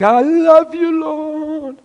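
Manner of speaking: high, slow, gushing worship voice; hammed-up sugary devotion to the Lord; mock worshipper. Mocking weekly worshippers.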